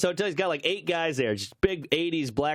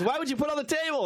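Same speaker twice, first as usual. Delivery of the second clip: higher, fast mock disbelief; incredulous Sinbad; 10 semitones higher, much faster than usual. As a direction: toss it off quick like he can't believe the guy. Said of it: "And he goes".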